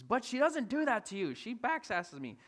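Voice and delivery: mock protest, slowing at the end; complaining wife. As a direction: plead your case as the wife. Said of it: "She says".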